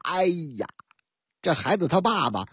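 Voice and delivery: ordinary delivery, nothing marked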